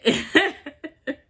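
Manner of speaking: high, real laugh; giggle. Real, not acted. Storyteller cracking up over her first smoking story, giggling.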